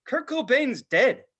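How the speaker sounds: high, slow taunting news; bully delivers news. Retold or imitated. Bully kid on the bus taunting, high and slow. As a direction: drop the news like a smug kid. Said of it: "And he's like".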